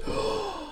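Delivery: sudden high gasp of inspiration; sharp inspired gasp. Retold or imitated. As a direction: gasp as the idea hits. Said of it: "comes up and goes".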